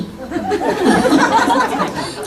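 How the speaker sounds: high giggling burst; amused laugh. Real, not acted. Speaker recalling how parents hid her, laughing. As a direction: let out a high, breathy giggle. Laughs about being hidden away.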